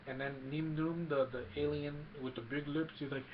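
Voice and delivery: normal talking voice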